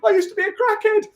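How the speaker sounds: very high put-on voice; daft confession. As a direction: confess it in a silly squeak. Acted jogger stopped by officer.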